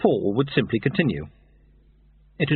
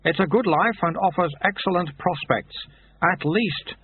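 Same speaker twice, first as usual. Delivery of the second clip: lightly voiced brisk recruiter, higher; a hint of a dry, slow-spoken monk; Soto's voice; 7 semitones higher than usual. Recruiter offers young thief a job, lightly voiced brisk monk.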